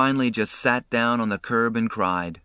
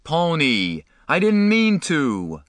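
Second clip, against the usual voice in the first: high, loud desperate cry; anguished, drawn-out yell; screaming; 7 semitones higher, 5 dB louder, slower than usual. A narrator's reading. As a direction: scream after someone running away. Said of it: "Derry screamed".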